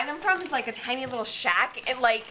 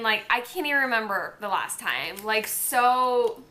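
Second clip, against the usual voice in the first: normal talking voice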